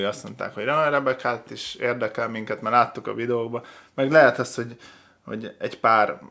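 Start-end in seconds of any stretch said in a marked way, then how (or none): none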